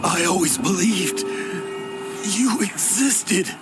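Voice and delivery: slow, high, emotion-filled voice; awed and overcome. Acted. Old adventurer to the one he believed in, overcome. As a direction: draw it out slowly, high with reverence.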